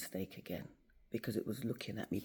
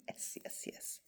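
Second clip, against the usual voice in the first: hushed, softer "yes" repeated under the breath; quiet, relieved triumph; whispered relief; 9 dB quieter than usual. Retold or imitated. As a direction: whisper it like you can't believe it worked. Quiet relief at email result.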